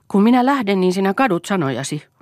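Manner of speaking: high, loud threat; threatening. A narrator's reading. Eero threatens to leave Lea, high and loud warning. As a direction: raise pitch and volume in warning. Acted threat in marital quarrel.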